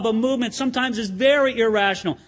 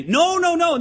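louder, urgent pleading with Paul; begging friends; 6 semitones higher, 3 dB louder than usual. Retold or imitated. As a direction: hold him back, plead hard. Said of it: "They have to beg him".

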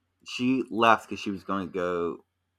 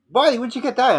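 high, louder teasing "buddy" voice; high mock buddy voice; 7 dB louder than usual. Friend teasing him about the pacifier, high mock voice. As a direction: pitch way up like a friend catching you out. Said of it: "he looks over and he goes".

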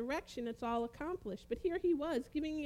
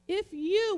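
"you" lifted high and loud; stress on you; 7 semitones higher, 7 dB louder than usual. Shown as a demonstration. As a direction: lean hard on "you". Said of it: "with a capital Y".

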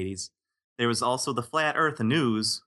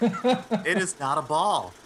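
mock serious; 7 semitones higher, much slower than usual. Joking about the ballroom.

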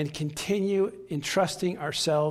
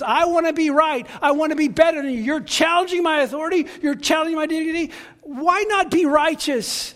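indignant shout; 10 semitones higher, 7 dB louder than usual. Retold or imitated. Preacher acts out arguing at home.

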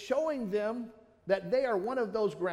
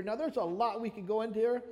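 ordinary talk, nothing marked

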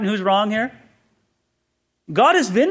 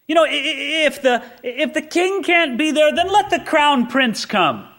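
high-pitched, stammering mock persuasion; acted son's eager pleading; coaxing; 9 semitones higher than usual. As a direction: wheedle Dad with a halting, raised voice. Acted son coaxing his father.

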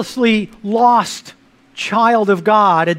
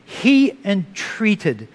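"he entreated." बहुत धीमे खींचकर; drawn-out, slow "he entreated."; stress on entreated; much slower than usual. Shown as a demonstration. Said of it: "I want you to know that word".

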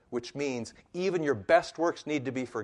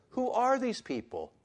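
high-pitched quoted question; preacher's dramatic repeated asking; rhetorical wondering; 12 semitones higher than usual. Acted. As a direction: ask it way up high, then let it fall.

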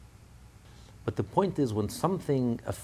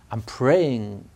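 louder emphasis on I'm praying; intense; 4 semitones higher, 7 dB louder than usual. Shown as a demonstration. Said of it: "with such intensity".

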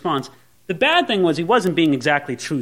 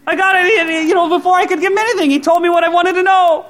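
thrilled; 12 semitones higher, 6 dB louder than usual. Acted. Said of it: "I know what Saul's thinking".

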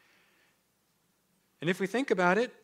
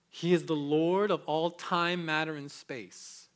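slow proclamation; 5 dB louder, slower than usual. Acted.

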